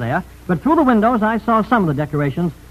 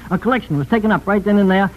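usual speaking voice

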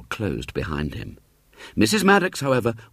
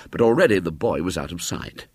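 ordinary delivery, nothing marked

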